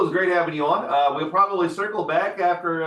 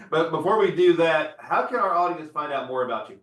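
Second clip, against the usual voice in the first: ordinary talk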